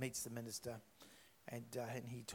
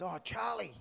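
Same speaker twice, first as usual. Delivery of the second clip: excited; 6 semitones higher than usual. Retold or imitated. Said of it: "He's all excited".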